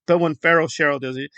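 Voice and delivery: ordinary talk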